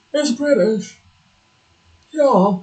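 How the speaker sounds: playful put-on British accent; mock British. Streamer teasing the British word, mock British accent. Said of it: "because it's British".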